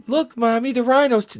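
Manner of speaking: high, tiny kiddie voice; little child. Acted. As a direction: pipe up like a kid calling Mommy.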